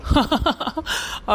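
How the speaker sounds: a short teasing breath-laugh; breathy laugh. Acted. Husband keeps laughing at his wife, airy giggle. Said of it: "ஏன்டா மாமா சிரிக்கிற?".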